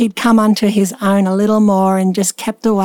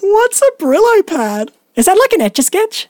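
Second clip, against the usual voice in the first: high puzzled; 11 semitones higher than usual. Real, not acted. Puzzled question about the product.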